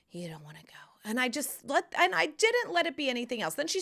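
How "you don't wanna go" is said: soft reassuring whisper; soothing whisper. Retold or imitated. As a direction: comfort her gently in a whisper. Soothing daughter.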